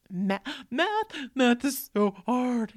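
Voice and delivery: high, slow, teary whine; whining kid. Retold or imitated. Mother voicing her son complaining about math, teary. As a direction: stretch it out like a sad boy.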